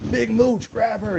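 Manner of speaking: high, sharp call to grab her; yelling for security. Retold or imitated. Calling a guard across the party, high urgent yell. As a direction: shout the guard's name high. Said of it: "So I yell".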